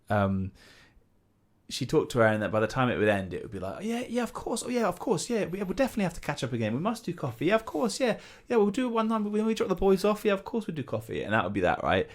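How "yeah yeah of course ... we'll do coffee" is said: very high, gushing agreement; hammed-up high, polite chatter; fake-enthusiastic mum. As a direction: imitate mum over-eagerly saying yes. Mum's false enthusiasm, imitated.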